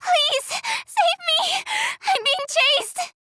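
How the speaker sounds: tearful, high plea for rescue; desperate plea. Acted. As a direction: beg for help while being chased. Pleading for rescue while chased.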